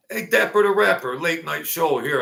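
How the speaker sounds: big welcoming shout-out. Real, not acted. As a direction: call out a hearty hello. Greeting chatter by name.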